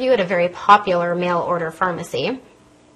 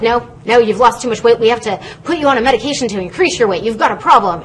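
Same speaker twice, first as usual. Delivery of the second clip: insistent specialist; 3 semitones higher, 5 dB louder, faster than usual. Retold or imitated. Specialist tells patient she lost too much weight, imitated insistence. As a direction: play a doctor urgently overruling the patient. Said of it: "this specialist was like".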